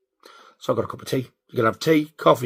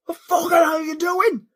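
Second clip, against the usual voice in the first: high, slow outraged shout; retold angry yell; 4 dB louder than usual. Driver confronting a queue-jumper, re-enacted angry yell. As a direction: re-enact yelling at another driver.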